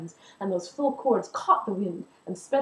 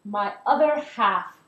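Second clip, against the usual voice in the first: tender; 4 dB louder, slower than usual. Acted. Tender reveal to the crowd.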